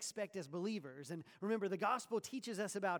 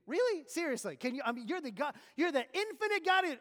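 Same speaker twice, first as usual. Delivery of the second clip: loud, high, disbelieving outburst; mock incredulous; 7 semitones higher, 10 dB louder than usual. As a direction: scoff at the idea in disbelief. Mock incredulous reply to the prayer.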